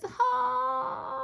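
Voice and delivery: very high, long, loud wail; stretched storybook shout; desperate call. A narrator's reading. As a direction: wail the word out as far as it will carry. Storybook chimp's desperate call to animals.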